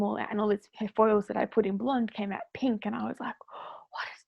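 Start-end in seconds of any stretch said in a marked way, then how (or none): 3.5-4.2 breathy panicked whisper. Retold or imitated. Dreaming hairdresser sees pink foils, panicked whisper. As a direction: whisper it in shock.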